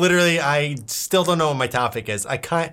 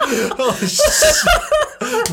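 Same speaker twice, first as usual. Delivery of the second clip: laughing hard. Real, not acted. Bursts out laughing.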